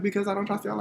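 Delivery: high-pitched mock protest; acting out a worked-up refusal; retold protest. Retelling own protest to relatives.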